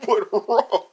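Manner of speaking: ordinary delivery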